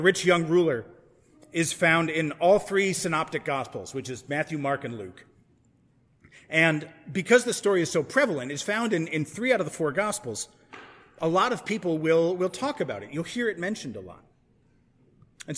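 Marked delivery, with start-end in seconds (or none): none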